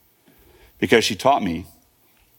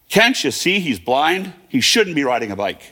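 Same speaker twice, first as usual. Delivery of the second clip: mildly loud, higher-pitched scolding call, stretched out; retold neighbor's yell; 6 semitones higher, slower than usual. Neighbor calling out to his mother about the boy, mock loud voice. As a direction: call it out in indignant reproach, half-yelled. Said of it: "to my mother in a loud voice".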